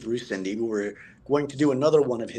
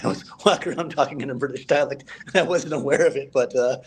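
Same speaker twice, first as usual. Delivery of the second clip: amused, higher-pitched, laughing through the words; laughing while talking; 5 semitones higher than usual. Real, not acted. Actor confessing his unwitting British dialect habit, laughing. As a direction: chuckle as you admit it.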